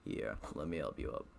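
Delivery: a low, soft deadpan corpse voice for Dream. Acted. Reading Dream's line to the reader in a low corpse voice. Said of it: "giving him a corpse voice".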